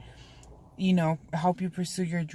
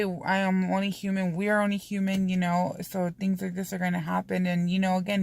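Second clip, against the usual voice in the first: ordinary talk, nothing marked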